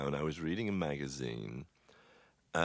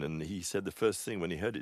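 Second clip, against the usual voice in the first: usual speaking voice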